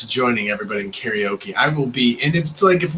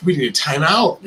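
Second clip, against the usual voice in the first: louder, higher, breaking into real laughter; laughing shout; 9 semitones higher, 4 dB louder, faster than usual. Real, not acted. Host cracking up while asking for a timeout, laughing shout. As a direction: blurt it out through the giggles, losing control.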